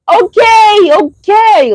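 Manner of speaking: high, loud drawn-out holler; mock shouting. Leo jumping out at a leaving car, exaggerated shout. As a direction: call it out like you're chasing after someone. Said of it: "hoping that you jump out and be like".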